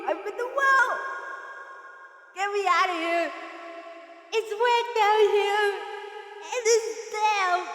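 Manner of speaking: high, far-off cries for help; hammed-up trapped in a well; calling from the well. Streamer pretends to be stuck down the well, high cries for help.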